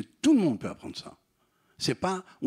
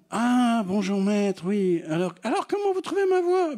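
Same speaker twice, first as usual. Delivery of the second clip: raised voice climbing to a squeak; operated high voice; 9 semitones higher, 4 dB louder than usual. Acted. Doctor recognises patient, she asks about her voice, climbing high. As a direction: imitate a patient showing off a raised voice.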